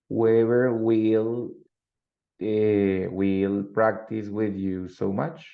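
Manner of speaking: drawn out word by word, rising at the end; slow, deliberate example for learners; slow model sentence. Shown as a demonstration. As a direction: dictate it slowly, stretching the words. Teacher models example sentence slowly.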